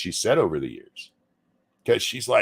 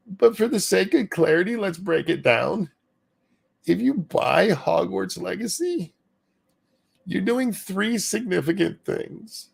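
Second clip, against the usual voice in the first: crying voice; 7 semitones higher than usual. Pretend. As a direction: read it as if about to cry. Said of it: "I was gonna keep doing the crying voice".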